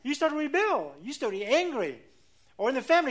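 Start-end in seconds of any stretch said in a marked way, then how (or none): none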